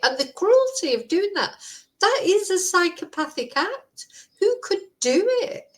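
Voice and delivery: high-pitched, incredulous outrage; real indignant disbelief; angry. Real, not acted. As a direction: demand to know how anyone could, voice rising high. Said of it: "I am just so angry".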